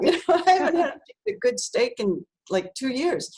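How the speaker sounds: giggling as she admits it; laughing. Real, not acted. Laughing to the interviewer about the steak.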